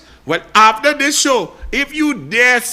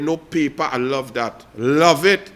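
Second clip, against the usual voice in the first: ordinary talk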